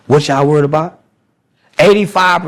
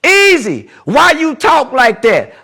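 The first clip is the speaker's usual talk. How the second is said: much higher and slow, teasing call-out; hammed-up heckler voice; mock heckler; 10 semitones higher, 3 dB louder, slower than usual. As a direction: play the guy calling him out. Voicing a heckler questioning him.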